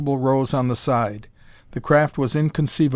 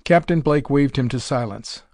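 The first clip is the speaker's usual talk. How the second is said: ordinary delivery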